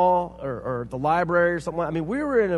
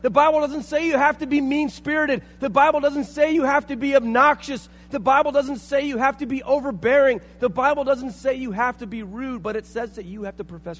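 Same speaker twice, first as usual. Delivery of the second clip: louder, higher sermon that keeps on building; building fiery preaching; 9 semitones higher, 7 dB louder than usual. Acted. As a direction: drive every line harder, fired up. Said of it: "You got me going".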